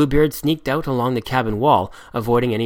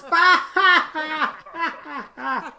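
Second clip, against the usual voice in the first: much higher, giggling mock insult; laughing mimicry; 14 semitones higher, slower than usual. A narrator's reading. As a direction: parrot the captain while laughing. Pirates mimic Redbeard laughing.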